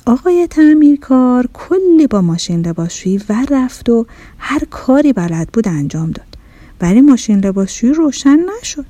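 ordinary delivery, nothing marked

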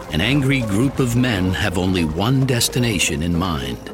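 ordinary delivery

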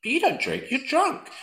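high mock teasing; friends teasing. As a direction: squeal it like friends ribbing you. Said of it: "all of them are like".

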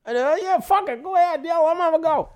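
high, offhand mock voice; careless boss. Colleague shrugs off the Scouse idea, high careless mock voice. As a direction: toss it off like a boss who doesn't care.

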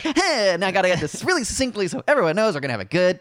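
high, fast eager host voice; chirpy host impression. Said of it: "And then I'm like".

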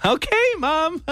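high mock sulky "okay mom"; sulky teen. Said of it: "And like".

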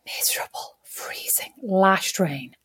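whispered misery. Real, not acted. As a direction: breathe the words out grimly. Describing awful weather.

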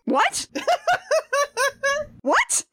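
high-pitched laughing disbelief; incredulous. Real, not acted. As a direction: squeak it out in amazed laughter. Disbelief at the casting news.

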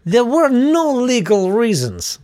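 high, slow, hammed-up Russian accent; bad Russian accent. Kebab shop owner protesting the closure, very bad Russian accent. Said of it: "in a very bad Russian accent".

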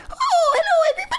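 squeaky, very high puppet greeting. Retold or imitated. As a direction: chirp it like a tiny sock puppet. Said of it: "Bosco is a puppet".

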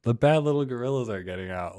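quoting the filming family. Retold or imitated. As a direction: lightly act out the onlookers. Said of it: "It was like".

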